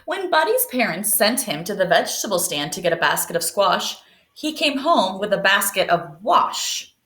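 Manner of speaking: put-on picture book read aloud to young pupils; reading to children.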